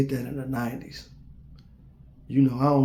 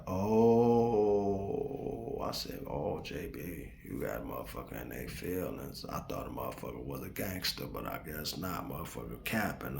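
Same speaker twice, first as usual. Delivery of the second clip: quiet mocking; 11 semitones lower, 13 dB quieter than usual. To himself, mocking the rival in a low mutter. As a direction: sneer it quietly under your breath.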